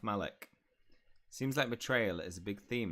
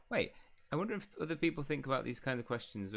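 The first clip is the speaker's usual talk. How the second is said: ordinary talk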